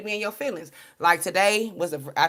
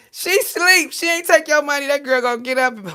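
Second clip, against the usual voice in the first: laughing outburst; 8 semitones higher, 8 dB louder than usual. Acted. Mock defending herself, laughing.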